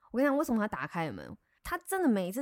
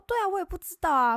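baffled; 10 semitones higher, 7 dB louder, faster than usual. Retold or imitated. Retold baffled agreement.

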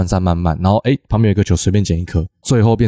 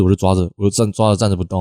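usual speaking voice

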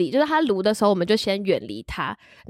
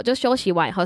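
ordinary delivery

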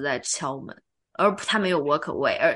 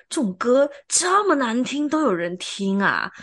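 incredulous husband; 5 semitones higher, 3 dB louder, slower than usual. Retold or imitated. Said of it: "然后他就：".

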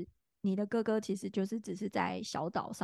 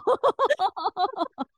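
high loud laughing burst; more laughter; 12 semitones higher, 7 dB louder than usual. Real, not acted. Still laughing at the friend's honest answer. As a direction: keep laughing.

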